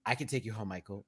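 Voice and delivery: low, quiet character voice; hushed, low mock offer; Dwight. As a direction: offer it low and soft, in imitation. Impression of Dwight offering a ride.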